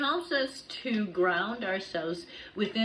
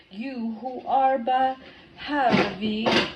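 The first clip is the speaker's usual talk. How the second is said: solemn, louder ritual recitation; invocation reading; 4 dB louder than usual. Acted. Reader intoning the card's invocation to the goddess.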